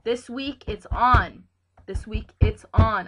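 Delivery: loud, determined hype; fired up. Real, not acted. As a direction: psych yourself up, loud and slow. Pumping himself up for the week.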